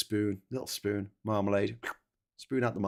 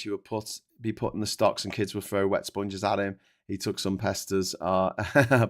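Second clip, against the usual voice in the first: normal talking voice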